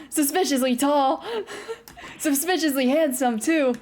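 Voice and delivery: teasing remark broken by real laughter; giggling. Real, not acted. Streamer teasing about the suspicious handsome stranger, giggling. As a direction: let giggles spill between the phrases.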